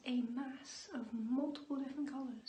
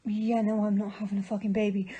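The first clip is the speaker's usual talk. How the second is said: loud, fast pained outburst; 8 dB louder than usual. Real, not acted. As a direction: blurt it out loud through the pain. Period pain outburst.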